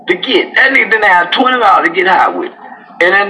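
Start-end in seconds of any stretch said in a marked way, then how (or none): none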